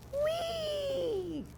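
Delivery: long high whee; gleeful whee. Acted. Boy swings on vine, long high whee. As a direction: sail out on a vine.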